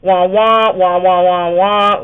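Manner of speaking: muffled trombone-like wah wah babble; Charlie Brown teacher wah. Retold or imitated. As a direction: drone wordless wah-wahs like a cartoon teacher. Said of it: "the Charlie Brown show, the instructors on that".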